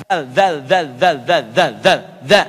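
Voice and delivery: "zal" as the Arabic ذال; Arabic dhal drill. Shown as a demonstration. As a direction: bite the tongue a bit on "zal". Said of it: "Lidahnya digigit sedikit".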